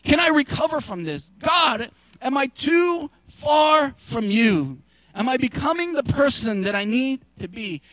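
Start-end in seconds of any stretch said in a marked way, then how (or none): none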